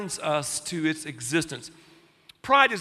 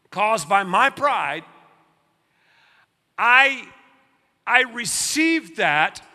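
prayer; 3 semitones higher than usual. Acted. Leads a prayer.

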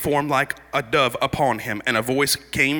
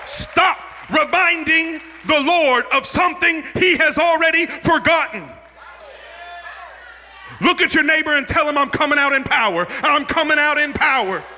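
high, driving sermon delivery; fired-up pulpit preaching; full-force preaching; 11 semitones higher than usual. Real, not acted. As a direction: preach it hard in a rising, ringing pitch.

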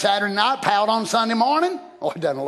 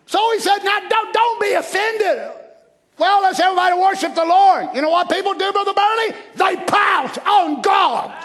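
high, raised preacher's voice; full-force preaching; 9 semitones higher, 4 dB louder than usual. Real, not acted. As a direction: drive it home to the congregation.

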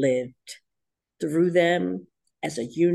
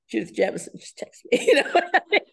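laughing; 8 semitones higher, faster than usual. Real, not acted. Laughing in disbelief.

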